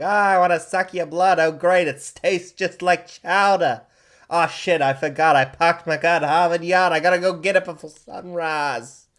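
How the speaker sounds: put-on loud, high New England townie accent; broad Boston-style New England accent, belted out. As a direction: play a brash New Englander with a thick accent. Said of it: "That was a good accent".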